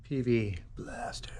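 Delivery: hushed, secretive whisper of the name. Acted. Mechanic whispering the product name to new viewers, hushed.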